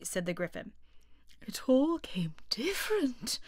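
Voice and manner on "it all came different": slow hushed whisper; musing quietly to himself; thoughtful. A narrator's reading. Mock Turtle pondering Alice's poem, whispered and thoughtful. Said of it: "repeated thoughtfully".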